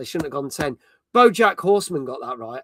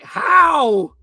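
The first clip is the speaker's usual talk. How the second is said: high, loud, incredulous cry of how; imitating a chat viewer who can't believe the score; mock outrage, pitched way up; disbelieving how; 6 semitones higher, 7 dB louder than usual. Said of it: "Kindred's like".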